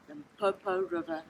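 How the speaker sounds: usual speaking voice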